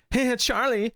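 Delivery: high squeaky cartoon greeting; annoying cartoon voice. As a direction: imitate a grating sidekick. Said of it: "it's like / annoying cartoon character".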